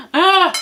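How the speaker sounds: high, exasperated "ugh"; exasperated groan. Retold or imitated. Swimmer fed up at being carried, exasperated groan.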